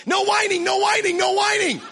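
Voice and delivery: high-pitched, loud mock toddler yell; toddler shouting. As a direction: imitate a little kid hollering over the noise. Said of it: "he'll start shouting".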